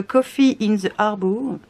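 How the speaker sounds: slow English in a French accent. Real, not acted. Vlogger reading a paper's English title, French accent. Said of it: "avec mon super accent anglais".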